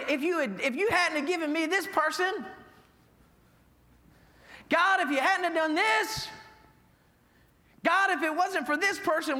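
high, loud whiny grievance; hammed-up griping to God; complaining. Churchgoers whine to God, loud mock complaint. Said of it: "we come to God complaining".

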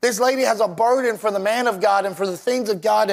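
usual speaking voice

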